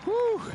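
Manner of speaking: impressed high whew; high whistling whew. Real, not acted. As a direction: let out an amazed whew. Reacting to a shot.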